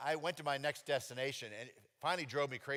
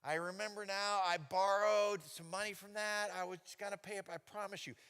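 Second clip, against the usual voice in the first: caught-out excuse; 8 semitones higher, 4 dB louder than usual. Retold or imitated. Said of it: "So I said".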